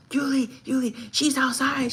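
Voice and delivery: urgent, high mock tattle; excited bagger tattling. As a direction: imitate an excited kid running in with news. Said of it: "This is the way he said it".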